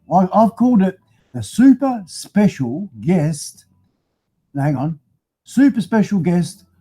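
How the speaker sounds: ordinary delivery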